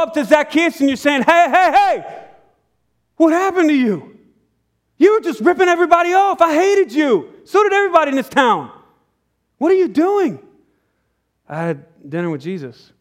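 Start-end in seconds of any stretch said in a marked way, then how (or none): 1.2-10.4 startled confrontation. Retold or imitated. Townsman stopping Zacchaeus in the street, high and incredulous. 11.5-12.9 low, soft and simple reply; quiet Zacchaeus. Retold or imitated. Zacchaeus telling the shocked townsman, low and soft.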